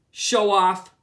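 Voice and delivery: loud, scornful show off; teasing. A narrator's reading. Susan taunting Chester as he stops in front, loud scorn. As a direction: tease him, loud and mocking.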